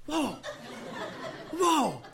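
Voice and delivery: high, falling exclamations of surprise; mock astonishment. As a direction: imitate startled onlookers. Imitating startled office onlookers.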